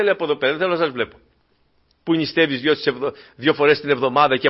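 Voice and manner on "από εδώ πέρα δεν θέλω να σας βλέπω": God dismissing the proud. Acted.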